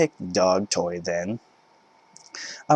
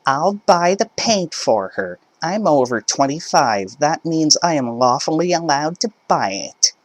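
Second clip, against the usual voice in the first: higher, self-satisfied voice; smug mother; 7 semitones higher than usual. Acted. Mother offering to buy it herself, high smug voice. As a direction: announce the loophole proudly. Entitled mother arguing at checkout.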